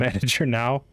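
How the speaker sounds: ordinary delivery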